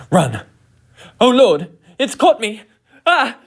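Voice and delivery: high, loud panicked yelling; dramatic panic. Acted. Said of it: "I'm being a little bit dramatic".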